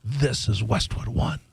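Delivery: lightly imitating a deep announcer; slow, low network ID. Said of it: "Do I sound like the guy from Westwood One?".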